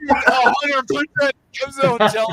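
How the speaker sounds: loud, high, cracking up mid-story; laughing while telling. Real, not acted. Storyteller to audience about friend's arrival, cracking up. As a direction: push the words out through laughter.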